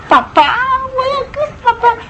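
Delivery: put-on high, crying little-kid whine; whiny child. Preacher voicing a toddler who wants dad, high crying whine. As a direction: whimper like a clingy toddler.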